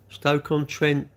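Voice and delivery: slow, drawn-out reading of "Stoke-on-Trent". Real, not acted. Streamer slowly reads a viewer's town from chat.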